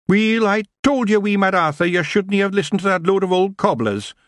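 high rough Scottish pixie; broad Scottish wee man; Scottish accent. Acted. Feagle Rob speaks in Scots.

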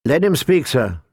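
plain reading voice

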